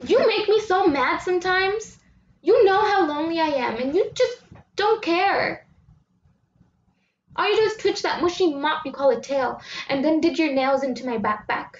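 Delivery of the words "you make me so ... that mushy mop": frustrated outburst. Acted. Scolding the cat in frustration.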